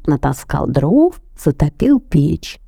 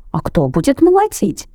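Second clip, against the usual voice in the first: high questioning rooster; rooster asking; 9 semitones higher than usual. A narrator's reading. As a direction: ask who will do the work. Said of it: "спросил петушок".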